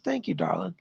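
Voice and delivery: breathy whispered thanks. Real, not acted. Psychic whispering thanks to a donor.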